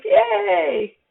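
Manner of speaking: very high, bright yelp of joy; excited dog. Retold or imitated. Teacher voicing the happy dog, a high excited yelp. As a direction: yelp like a thrilled puppy. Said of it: "and the dog goes".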